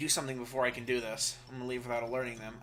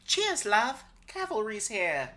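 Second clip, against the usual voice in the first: cockney voice; 8 semitones higher, slower than usual. Pretend. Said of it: "the rest of the voices I've tried to do".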